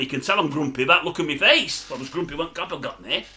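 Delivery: ordinary talk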